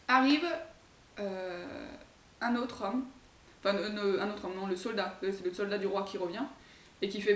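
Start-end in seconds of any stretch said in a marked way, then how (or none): none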